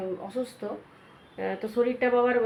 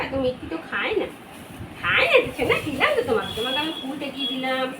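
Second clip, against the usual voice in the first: high playful pet voice; talking to the dog; 5 semitones higher, 6 dB louder than usual. Real, not acted. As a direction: tease the puppy fondly. Teasing dog about sweets.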